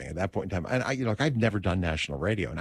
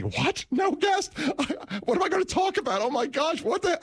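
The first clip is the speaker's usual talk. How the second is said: much higher, frantic panicked squeal; inner panic scream. Retold or imitated. Host panicking inside his head on air, high frantic squeal. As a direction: panic as the show collapses around you. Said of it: "I'm screaming".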